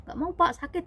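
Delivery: high, loud plea to be spared; protesting refusal. Retold or imitated. Patient begging off a painful massage, protesting refusal. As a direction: re-enact a pained refusal.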